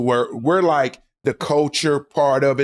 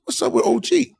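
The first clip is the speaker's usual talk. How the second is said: laughing mock quote; 10 semitones higher than usual.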